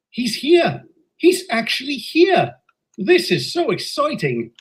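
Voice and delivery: high, thrilled gush of delight; excited. Acted. Young engine to grandpuff about the visitor, thrilled. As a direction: bounce with eagerness, voice up high.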